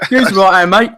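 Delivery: Scouse corner man shouting advice, mock accent. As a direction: repeat the line as a hammed-up Scouser. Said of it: "hybrid Scouser accent".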